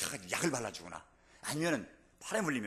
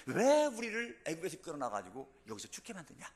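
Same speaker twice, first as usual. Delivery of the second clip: drawn-out, raised-pitch mock complaint; grumbling protest; 5 semitones higher, 4 dB louder than usual. Preacher voicing the Israelites' grumbling against Moses, complaining. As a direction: act out an indignant crowd's grumbling. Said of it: "불평하기 시작하는 거예요".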